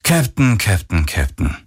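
falling, sighed reproach; sighing. A narrator's reading. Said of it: "ertönte es seufzend aus ihrem Komm". (seufzend, tadelnd)

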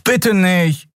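short high-pitched plea; pleading. A narrator's reading. Miko begs his card opponent not to, pleading. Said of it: "flehte Miko".

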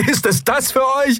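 very high-pitched indignant cry; outraged question. A narrator's reading. Bearded man to the captain, outraged cry. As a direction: demand it in outrage.

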